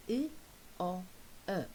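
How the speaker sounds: "I, O, A" as three short separate vowel sounds; isolated vowel sounds. Shown as a demonstration. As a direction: sound out "I, O, A" with pauses between. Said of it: "identify the vowel sounds".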